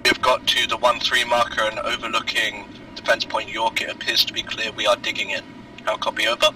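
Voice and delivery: faint, distant radio report; crackly military radio. Real, not acted. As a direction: transmit over a weak field radio. Unit reports over radio.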